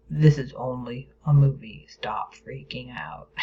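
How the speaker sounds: low, slowing to a hushed finish; logical inner voice. Acted. Logical brain talking down a scared moviegoer, low and firm. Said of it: "it logically said".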